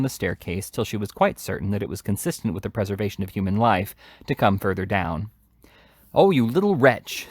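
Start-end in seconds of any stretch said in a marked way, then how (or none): 6.1-7.3 higher, louder furious scream. A narrator's reading. Charlotte seizing Oliver, screaming at him. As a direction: shriek at him in rage. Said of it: "screamed Charlotte".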